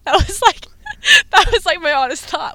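high, loud words through real laughter; laughing. Real, not acted. Patient admitting her honest first thought, laughing. Laughs while telling own story.

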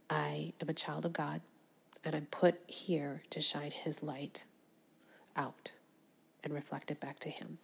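soft, hushed devotion; whispered prayerful affirmation. Real, not acted. Speaker ending on a whispered prayer of faith.

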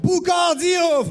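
slow, stretched, high shout; drawn-out shout. Pretend. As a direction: call it out like an announcer, dragged out. Mock announcing a long intro.

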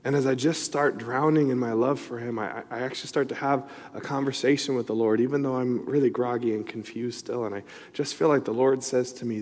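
ordinary talk

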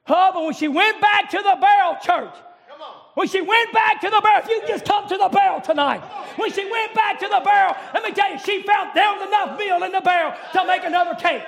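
loud, chanting sermon climax; fiery preacher's repeated refrain; preaching at full force. Acted. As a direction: drive each line home to the church.

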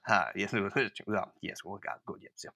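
imitated bartender muttering away to nothing; trailing mumble. Bartender answering as he walks away, mumbling softly. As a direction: fade from soft to barely audible muttering.

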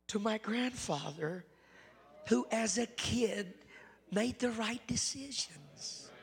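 put-on pleading with young people, pitched high; preaching at full force. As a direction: lift it into a pulpit appeal. Preaching climax to youth.

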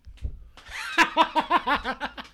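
loud cackling laughter; high, loud laugh. Real, not acted. Podcast host cracking up at his friend's admission, laughing high and loud. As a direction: burst out laughing, high-pitched.

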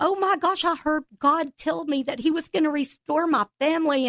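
high, bright burst of joyful news; excited retelling. Retold or imitated. Telling her husband the news, thrilled and high. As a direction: gush the good news up high.

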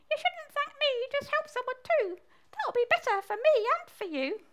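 high, squeaky frog voice. Acted. Frog passes advice to baby mole, high squeaky voice. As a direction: speak right up high as a tiny frog.

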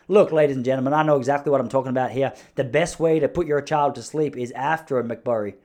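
confident mock American accent at full volume. Shown as a demonstration. Cocksure American addressing ladies and gentlemen, American accent. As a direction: play a cocksure American pitchman. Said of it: "you do the American accent".

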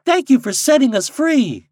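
high, loud chorus of thanks; birds crying out. A narrator's reading. Freed birds to Popopo, crying out their thanks. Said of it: "the birds cried".

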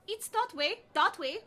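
put-on light Indian accent, called out; East Indian accent. A narrator's reading. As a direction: Indian street vendor calling out. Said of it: "shouted the pretzel man in an East Indian accent".

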